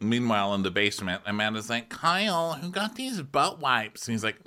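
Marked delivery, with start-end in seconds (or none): 1.9-4.1 high, slow imitation; mock whiny question. Imitating Amanda calling to Kyle, high slow whine.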